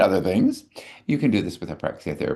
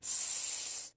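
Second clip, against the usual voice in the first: very long soft hiss; long snake hiss; 17 dB quieter than usual. Shown as a demonstration. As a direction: hold the hiss as long as possible. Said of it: "the longest snake sound in the world".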